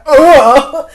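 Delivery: big, high audience groan of revulsion; loud disgusted groan. Retold or imitated. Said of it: "everyone in the theater was like, verbally went".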